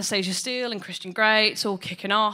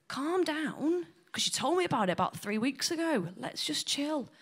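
softer, soothing; calming; 5 semitones higher, 7 dB quieter than usual. Retold or imitated. Son calming his eager mum, softer soothing. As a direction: settle her down gently.

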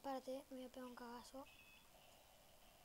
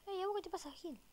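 indignant; 6 semitones higher than usual. Real, not acted. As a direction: snap at the character. Schimpft Spielfigur an.

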